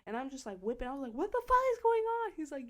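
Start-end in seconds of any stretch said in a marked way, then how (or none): 1.1-2.3 high, loud alarmed yell; panicked shout. Retold or imitated. Driver screaming at friend during the chase, panicked shout. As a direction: yell it mid-getaway in panic.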